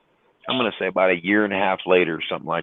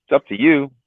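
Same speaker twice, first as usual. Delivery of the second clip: lightly voiced recruiter, higher and offhand; smuggler's shrug; 7 semitones higher than usual. Trucker voicing the smuggler, a higher offhand shrug. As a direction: toss it off like a shady recruiter.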